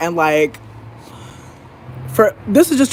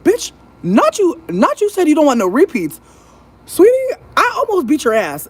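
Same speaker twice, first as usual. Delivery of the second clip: outraged retort; 9 semitones higher than usual. Retold or imitated. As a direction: blast her with disbelief. Furious retort to her mom.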